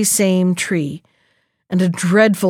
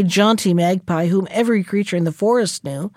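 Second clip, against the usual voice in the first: plain reading voice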